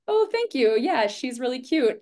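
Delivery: high-pitched cheery reply to a compliment; sweet, friendly thanks. Retold or imitated. Acting out cheery reply to admirer.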